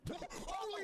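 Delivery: high gasping amazement; gasp, then cry out high; amazed exclamation. Real, not acted. Amazed at the result.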